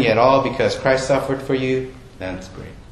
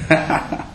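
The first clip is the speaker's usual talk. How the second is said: breathy laugh; 4 semitones higher than usual. Real, not acted. Laughs after asking if toxic.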